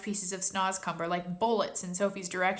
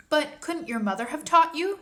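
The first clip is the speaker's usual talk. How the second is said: put-on young girl voice; 7 semitones higher, 5 dB louder than usual. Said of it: "Sophie asked".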